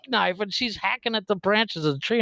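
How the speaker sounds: usual speaking voice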